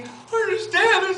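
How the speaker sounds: very high, drawn-out mock complaint; mock whining. Recruit coming to the trainer, mock whining. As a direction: imitate a complainer in a slow, squealing high voice.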